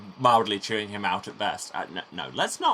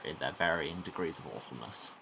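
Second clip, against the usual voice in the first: usual speaking voice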